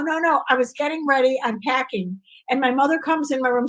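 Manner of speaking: ordinary delivery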